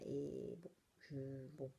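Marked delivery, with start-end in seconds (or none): 1.6-1.7 laughing ironic aside. Real, not acted. Joking to viewers that it sounds like an ad, laughing. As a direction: laugh through the joke about the ad. Jokes it's an ad.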